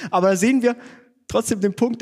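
real laughter bubbling into the sentence; laughing. Real, not acted. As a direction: laugh it off while getting back on track. Laughing after wife's critique.

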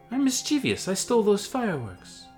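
put-on high, eager young hobbit. Hobbit protests to the hat.

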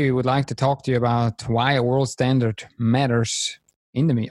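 normal talking voice

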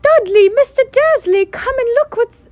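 put-on very high, loud shouting boy; excited boy screaming for his father; deafening shout. A narrator's reading. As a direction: yell across the reptile house. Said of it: "A deafening shout".

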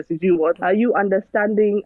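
normal talking voice

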